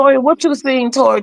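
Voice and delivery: high, eager asking for news; hungry for the tea; eager gossip. Retold or imitated. Mama D asking Tori for gossip, eager.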